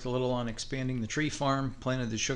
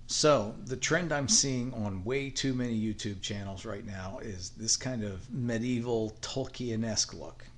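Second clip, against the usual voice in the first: usual speaking voice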